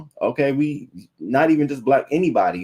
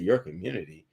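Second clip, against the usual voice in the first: deadpan disbelief; 4 semitones lower, 7 dB quieter than usual. Retold or imitated. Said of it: "I said".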